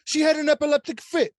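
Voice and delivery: high, loud mock Italian outburst; Italian accent. Landlord lamenting his wife's fit, loud put-on Italian accent. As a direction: wail it in a hammy Italian accent. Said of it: "doing an Italian and shit".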